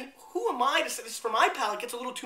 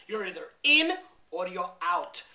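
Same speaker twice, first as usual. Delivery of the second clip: awful impression; 4 semitones lower than usual. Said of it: "another awful impression".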